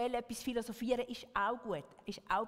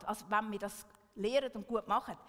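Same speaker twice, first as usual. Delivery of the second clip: ordinary talk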